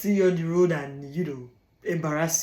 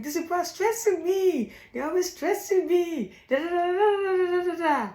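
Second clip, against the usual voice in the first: whiny complaining self; 11 semitones higher than usual. Retold or imitated. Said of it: "And then you're like".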